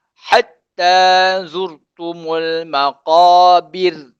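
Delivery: slow, drawn-out recitation; teacher modelling the verse for students, slow recitation; Quran recitation. Shown as a demonstration. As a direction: chant the verse slowly and evenly.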